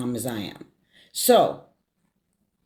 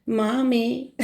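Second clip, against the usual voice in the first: tender greeting; 6 semitones higher than usual. Retold or imitated. Retold phone greeting to mom.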